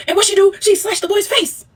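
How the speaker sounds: outraged disbelief; high shocked gasp of a recap. Real, not acted. Shocked retelling.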